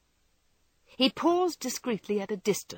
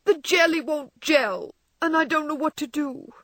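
despairing sob; 8 semitones higher, 4 dB louder than usual. A narrator's reading. Wife sobbing to her husband about the failed jelly. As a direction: whimper it out, close to crying. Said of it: "sobbed Meg despairingly".

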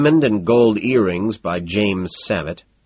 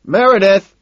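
high, loud hail; loud, sustained high call; 13 semitones higher, 10 dB louder, slower than usual. A narrator's reading. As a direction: hail someone across a crowded party. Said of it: "he calls out, waving".

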